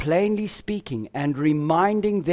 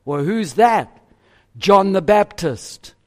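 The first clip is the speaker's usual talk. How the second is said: ordinary delivery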